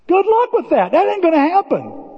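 "Good luck with that" said high and mocking. Shown as a demonstration. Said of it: "repeated much higher".

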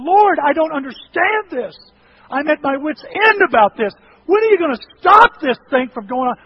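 high, loud desperate pleading; impassioned re-enacted yell, pressing each question; yelling in prayer. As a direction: cry out to God at wit's end. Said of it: "And I start yelling and screaming".

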